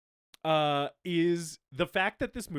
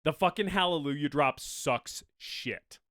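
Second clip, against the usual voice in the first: ordinary delivery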